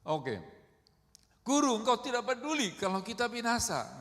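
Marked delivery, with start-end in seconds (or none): 1.5-4.0 high, loud acted reproach; angry disciples' protest. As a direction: voice angry disciples accusing their teacher. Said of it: "Sebenarnya murid-murid itu marah". (protes marah yang diperagakan, keras dan tinggi)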